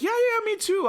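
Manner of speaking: high, squeaky, overeager yeah yeah; mock eager agreement. Recapper mocks Cassie eagerly agreeing with Adam, squeaky and overdone.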